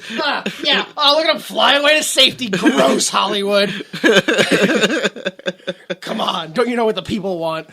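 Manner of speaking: loud, high mocking sneer with laughs; cynical Danny impression. Mocking Danny scorning Hollywood, loud laughing sneer. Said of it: "Cynical Danny".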